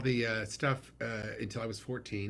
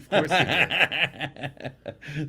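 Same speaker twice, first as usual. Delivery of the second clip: very slow, stretched out and louder while laughing; 5 semitones higher, 10 dB louder, much slower than usual. Real, not acted. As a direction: drawl it through laughter. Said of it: "laughing reply about father's girlfriend".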